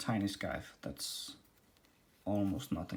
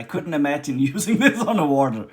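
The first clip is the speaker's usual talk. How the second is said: chuckling through the words, a bit louder; laughing; 3 semitones higher, 10 dB louder than usual. Real, not acted. Reviewer laughing at a manual telling him to use the machine underwater, amused and louder. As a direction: laugh it off, amused at the odd translation.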